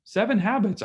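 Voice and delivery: high, brighter recognition; light up at the book's name; pleased surprise. Retold or imitated. Businessman on the plane recognizing the book, mild surprise. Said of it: "he goes".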